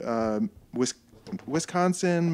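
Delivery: usual speaking voice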